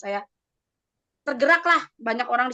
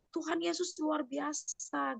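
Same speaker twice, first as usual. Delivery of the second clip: slow, soft hushed exclamation; neighbour's cry of praise, retold quietly and slowly; 4 semitones higher, 10 dB quieter, slower than usual. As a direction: say it slowly under the breath in awe. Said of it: "tadi dia teriak".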